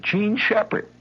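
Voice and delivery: put-on high, small-boy announcement; earnest little boy. Boy tells the mother his full name. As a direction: say your name proudly.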